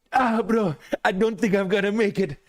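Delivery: put-on strained high Indian accent voice. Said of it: "my impression of an Indian guy with his leg caught in a bear trap".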